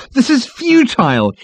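high, loud, slow outburst; exclaiming. A narrator's reading. Ford leaps up at the committee, exclaiming. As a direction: burst out in exasperation. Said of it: "he exclaimed".

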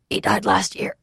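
strained, whispery answer; hoarse whisper. A narrator's reading. Fugitive telling the Waylord of his father's death, hoarse whisper.

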